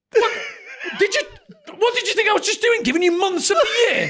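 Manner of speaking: high, loud, spluttering disbelief; mock incredulous outburst. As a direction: rant as if retelling a maddening phone call. Acted outburst at confused operator.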